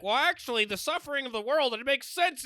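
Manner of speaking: high-pitched mock rationalizing voice; Maddie. Comic mocking Maddie talking herself into heroin, high-pitched imitation. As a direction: argue it in a high, earnest imitation. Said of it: "'cause she'd be like".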